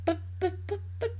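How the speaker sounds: high, halting stutter on each word; stammering. A narrator's reading. George's mother lost for words at the sight, stammering. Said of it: "stammered George's mother".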